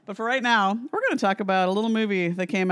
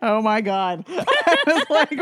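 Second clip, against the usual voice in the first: laughing; 3 semitones higher, much slower than usual. Real, not acted. Laughing at the Brody story.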